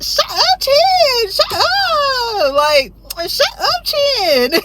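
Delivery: very high, squealing embarrassed shut up, half laughing; flustered squeal. Retold or imitated. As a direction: shriek it at him, mortified and giggly. Flustered girl yelling at teasing boy.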